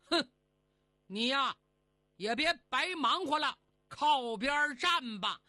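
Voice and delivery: mildly scornful immortal; cold sneer. Acted. Immortal tells the fairy to step aside, mild cold sneer. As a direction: brush her off with a sneer. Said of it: "冷冷笑道".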